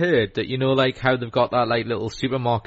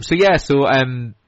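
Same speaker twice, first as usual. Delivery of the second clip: normal talking voice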